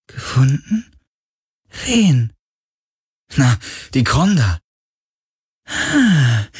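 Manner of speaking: high, breathy spirit voice; eager ancient spirit asking after old friends; ghostly spirit. A narrator's reading. Ghost asks Carlin about his father Vago, high spirit voice.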